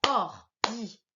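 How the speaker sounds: "ordinateur" in four separate beats, or-di-na-teur; "ordinateur" with a short gap after each syllable; split into syllables. Shown as a demonstration. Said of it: "la première fois que je tape des mains".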